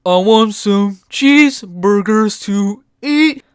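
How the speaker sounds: slow goofy put-on voice; dopey voice. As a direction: dumb cartoon drawl.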